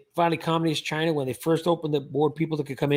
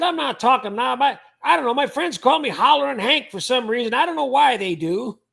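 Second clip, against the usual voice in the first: hollering; 6 semitones higher than usual. Retold or imitated. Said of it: "Hollerin' Hank".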